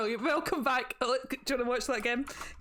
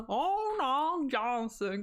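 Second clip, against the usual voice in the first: ordinary delivery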